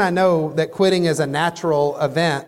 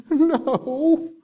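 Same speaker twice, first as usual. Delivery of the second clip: put-on higher, small kid voice saying no; small child answer; 9 semitones higher than usual. Daughter answering dad's question, a small higher no. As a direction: answer like a sheepish little girl.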